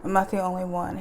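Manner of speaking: ordinary delivery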